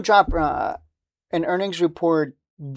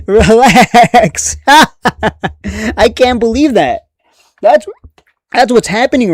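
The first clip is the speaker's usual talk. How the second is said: real laughing, higher and louder, drawn out; laughing exclamation; 5 semitones higher, 6 dB louder, much slower than usual. Real, not acted. Streamer telling panicking investors to calm down, laughing loudly. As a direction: burst out laughing through the words.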